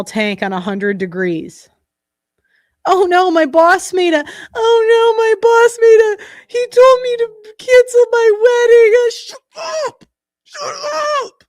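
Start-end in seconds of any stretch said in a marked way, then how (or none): 2.9-8.9 high, whiny mock complaint. Streamer mocking a woman's complaint in a high whiny voice. 9.0-11.4 shrill shouted shut up, much higher; heated shouting. Real, not acted. Streamer getting heated, yelling shut up at the clip. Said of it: "God, I'm getting heated".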